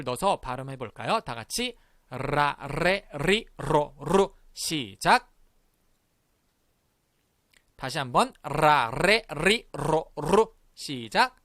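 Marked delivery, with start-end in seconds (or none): 2.1-4.3 "라, 레, 리, 로, 루" each starting with a trill; rolled R syllables. Shown as a demonstration. Teacher leading class through vowels, rolled R syllables. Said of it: "요렇게 발음을". 8.4-10.5 "라, 레, 리, 로, 루" each starting with a trill; rolled R syllables. Shown as a demonstration. Teacher leading class through vowels, rolled R syllables. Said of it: "요렇게 발음을".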